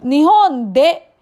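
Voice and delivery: usual speaking voice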